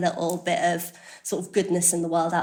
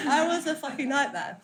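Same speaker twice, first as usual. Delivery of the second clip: repeated through laughter, higher; laughing; 8 semitones higher than usual. Real, not acted. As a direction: say "I was a fucking nightmare" still laughing. Repeats line while laughing.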